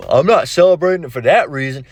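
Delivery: lightly put-on mock voice, rising on the middle line; a hint of a mocking impression; mocking borrowed voice. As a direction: mimic how they sound when they talk. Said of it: "I'm using, uh, that voice that".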